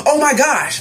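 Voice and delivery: shocked cry at a horror; horrified gasp. Retold or imitated. Narrator seeing the girl stab the baby, horrified high cry.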